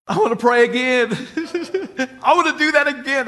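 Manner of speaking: high, loud, laughing with joy; overjoyed. Retold or imitated. Young man after praying, high loud overjoyed cry. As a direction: gush it out, can't contain the joy. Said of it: "And he says".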